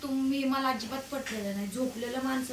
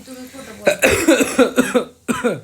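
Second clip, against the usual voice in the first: slow, much louder drawn-out accusation; 14 dB louder, much slower than usual. Real, not acted. Mother loudly accusing father of being the liar. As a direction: throw the blame back hard.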